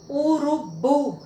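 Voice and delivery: slow urubu with BU held; stress on last syllable. Shown as a demonstration. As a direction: sound out urubu, stressing the final bu. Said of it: "é sílaba tônica".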